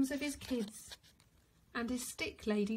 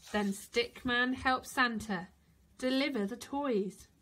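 usual speaking voice

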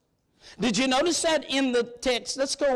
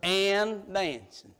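slow teasing reveal; 3 dB quieter, much slower than usual. Shown as a demonstration. Preacher teasing Baptists with a slow drawn-out reveal. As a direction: draw out and dancing slowly, teasing. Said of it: "you Baptists, hold your ears".

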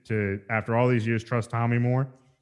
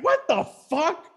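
high, slow disbelief; mock high-pitched shock; stunned; slower than usual. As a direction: squeal it out, baffled. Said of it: "And I'm just like".

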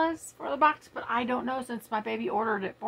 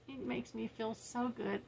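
ordinary talk, nothing marked

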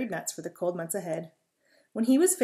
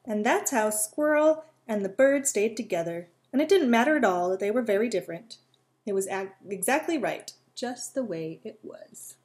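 lively read-aloud voice with little character voices; storytelling; 3 semitones higher, 7 dB louder than usual. A narrator's reading. Reading a squirrel and bird story to children, lively. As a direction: tell a bedtime story to young listeners.

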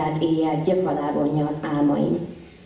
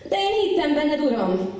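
high-pitched cry that drops at the end; pleading protest; 8 semitones higher than usual. Acted. Believer reproaching God for a broken promise, high pleading protest. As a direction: protest to the Lord, starting high.

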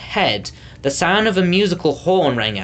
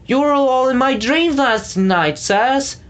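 crying out; 10 semitones higher than usual. A narrator's reading. A third fan cries out adoringly to the sirs. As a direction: gush it out in adoration. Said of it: "cried a third".

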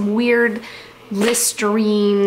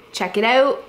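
ordinary talk, nothing marked

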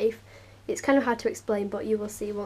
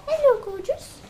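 much higher, louder sing-song hello; pet voice; 14 semitones higher, 9 dB louder than usual. Real, not acted. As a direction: coo up high at the animal. Greeting the pet.

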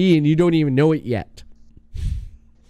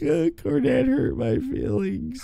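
high whiny mockery of the complainers; 8 semitones higher, 4 dB quieter than usual. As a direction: whimper it like a hurt crybaby. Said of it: "They're like".

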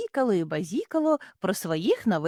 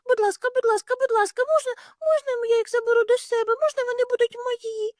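very high, fast pleading; begging child; faster than usual. Acted. Little hare begs parents to keep kittens. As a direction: beg like an eager little kid. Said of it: "запитало маленьке зайченятко".

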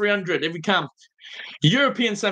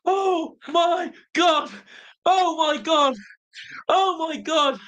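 high, drawn-out shouts of disbelief; real euphoric shouting; stunned joy; 8 semitones higher, slower than usual. Real, not acted. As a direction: cry it out, overwhelmed. Disbelief after shootout win.

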